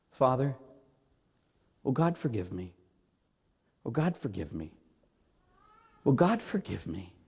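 soft, low plea for forgiveness, lightly acted; imitated tearful penitent. Old man confessing to the priest, softly imitated tearful plea. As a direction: retell a broken confession quietly, with long pauses. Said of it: "The guy just starts crying".

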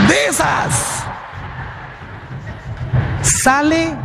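imitating a showy church reader; mock fancy lector. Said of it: "de estas que salen así".

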